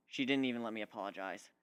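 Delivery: ordinary delivery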